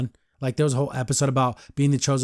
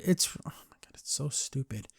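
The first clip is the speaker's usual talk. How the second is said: soft whispered aside, fed up; hushed eye-roll aside; exasperated whisper; 10 dB quieter than usual. Real, not acted. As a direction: drop to a whisper in disbelief. Exasperated aside about the storyline.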